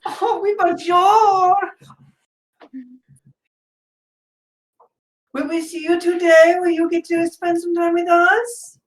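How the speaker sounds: very high, loud, gushing nun voice; Sister Obscura delighted. Acted. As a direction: squeal happily at an old pupil.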